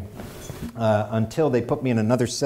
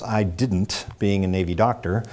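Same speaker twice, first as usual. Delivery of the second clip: ordinary talk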